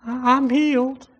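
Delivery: high-pitched, hesitant mock attempt; unconvinced declaration. Shown as a demonstration. Preacher mimicking a doubter claiming healing, high and unconvinced. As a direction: stumble into a small, high claim without conviction. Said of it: "You gotta say it with a conviction".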